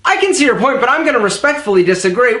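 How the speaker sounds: rushed, fast delivery; too fast. Shown as a demonstration. Demonstrating to viewers how talking too fast sounds. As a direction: race through the words. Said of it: "let's do a little experiment".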